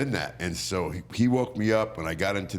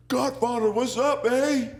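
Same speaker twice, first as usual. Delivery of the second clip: much higher, chirpy Canadian guard voice; Canadian guard greeting a wrestler, much higher and cheery; Canadian border guard; slower than usual. Retold or imitated. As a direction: squeak it out like a cheery Canadian officer. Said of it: "the guy goes".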